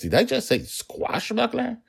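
high, baffled self-questioning; puzzled at his own slip of the tongue; mock-astonished father, pitch jumping; surprised at himself. A narrator's reading. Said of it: "He seemed surprised".

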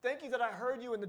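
put-on soft, hushed grateful child; a child softly thanking mom; tender thanks. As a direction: quietly thank her from the heart. Child thanking mother, tender imagined.

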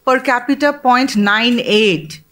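ordinary talk

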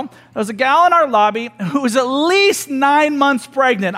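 ordinary delivery

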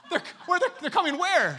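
high, loud, stammering question; startled disbelief. Retold or imitated. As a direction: stumble over it in comic alarm. Preacher acts out his startled reply.

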